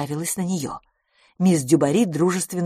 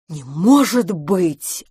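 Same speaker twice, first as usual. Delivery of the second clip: slow, louder disbelief; astonished gasp; 5 dB louder, much slower than usual. A narrator's reading. Miss Pettigrew hears about the hair, astonished. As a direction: stretch it out in amazement. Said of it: "ахнула мисс Петтигрю".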